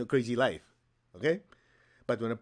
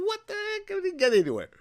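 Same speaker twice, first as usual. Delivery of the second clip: very high, loud squeal of disbelief; comic frustration; 4 dB louder than usual. Retold or imitated. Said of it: "I'm going".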